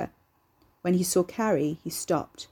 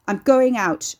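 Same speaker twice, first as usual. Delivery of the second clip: high, loud angry yell; 4 semitones higher, 8 dB louder, slower than usual. Acted. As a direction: yell it out, fists clenched. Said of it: "Ricky yelled".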